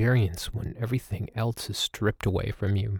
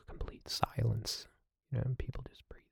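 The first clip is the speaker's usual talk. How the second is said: whisper; 11 dB quieter than usual. Real, not acted. Describing silent meditation hall.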